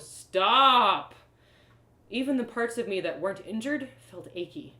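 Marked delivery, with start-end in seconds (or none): 0.0-1.1 loud, high yelled stop. Acted. The narrator's body yelling at her to stop. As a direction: bark it like the body's alarm. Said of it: "my body yelled".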